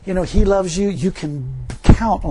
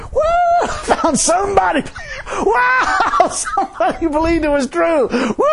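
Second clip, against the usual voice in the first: much higher, loud laughing whoops of delight; giddy high-pitched cheering, bursting with laughter; Jesus whooping with joy; 14 semitones higher, 3 dB louder, slower than usual. Acted. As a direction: shout and laugh like you just got the best news. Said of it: "He did a little jig".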